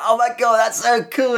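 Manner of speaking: very high falsetto fanboy squeal; acting out giddy excitement, way up high. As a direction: squeal with giddy, mock-breakneck glee. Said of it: "I was just giddy".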